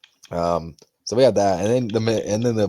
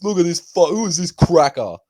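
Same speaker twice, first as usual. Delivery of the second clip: high, loud mock taunt; fighter taunting; 10 semitones higher, 6 dB louder than usual. Fighter mocking rival at press conference, high loud taunt. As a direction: sneer it like a cocky fighter.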